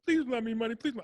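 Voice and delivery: high pleading mock whine; mock begging. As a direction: mimic investors begging for money. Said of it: "They're all out there doing".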